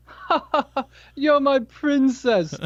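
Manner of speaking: high, slow put-on voice with a forced laugh; hammed-up mock chuckle into a silly line; goofy movie impression. Husband teasing his annoyed wife, goofy imitation. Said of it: "You know, just stupid stuff like that".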